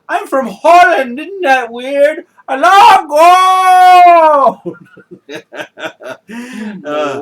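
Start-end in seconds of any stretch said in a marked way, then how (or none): 0.1-4.8 high, loud goofy foreigner; silly foreign voice. Pretend. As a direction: ham it up as a goofy Dutchman, shouting at the end. Acted silly foreign guy.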